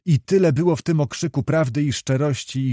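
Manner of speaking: plain reading voice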